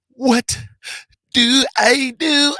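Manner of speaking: high, slow, shouted shriek; shrieking catchphrase. Acted. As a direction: belt it out way up high. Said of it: "see my face when I hit that shit".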